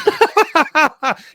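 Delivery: high, loud maniacal laugh; long, pealing mad cackle; crazy villain cackle. Shown as a demonstration. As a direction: cackle like a flamboyant madman. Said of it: "a crazy cat chap".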